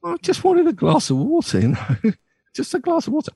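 mock high plea; high squeaky plea. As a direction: squeak out the request. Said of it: "He said".

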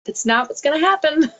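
firm, high-pitched divine correction; firm voice of the Lord. Retold or imitated. Retelling how the Lord answers her excuses, in a firm, high voice. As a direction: overrule her fears with a playful, firm high voice. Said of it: "And the Lord's like".

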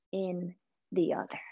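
whispered sermon climax. Real, not acted. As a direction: drop to a breathy whisper. Sermon climax on seeing God.

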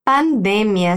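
"Pandemia" stressed on "de", pan-DE-mia; teacher models "Pandemia" with the stress on "de"; Spanish stress. Shown as a demonstration. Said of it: "se dice así de manera distinta del portugués".

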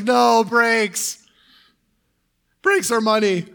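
high, loud comic protest to his money; mock desperate plea. Pastor pleading with his own money, mock desperate and high. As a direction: playfully beg the money not to go.

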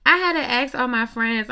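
loud, fired-up opening to the rant. Real, not acted. Vlogger ranting to her viewers, loud and fired up. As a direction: blast it out, worked up.

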